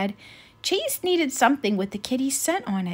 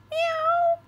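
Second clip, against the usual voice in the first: very high frightened little mew; whimpering meow. Acted. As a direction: whimper like a scared kitten. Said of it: "Precious whimpered".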